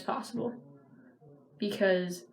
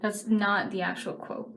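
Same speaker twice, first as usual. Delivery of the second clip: normal talking voice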